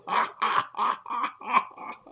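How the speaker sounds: high, helpless mock laughter; imitated belly laugh. Host creased up at his fallen guest, high imitated laughing. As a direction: act out someone killing himself laughing. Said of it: "laughing, going".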